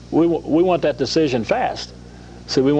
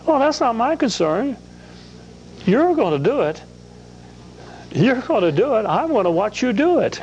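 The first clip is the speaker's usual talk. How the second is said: higher, carefree Philip trusting Jesus; voicing Philip; 9 semitones higher than usual. Guest voicing a trusting Philip answering Jesus, higher. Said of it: "he'd say it like this".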